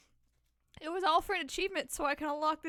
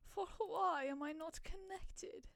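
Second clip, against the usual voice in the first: slow whispered question; whispering; 5 dB quieter, slower than usual. Real, not acted. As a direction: breathe it out slowly, puzzled. Confused whispered question to self.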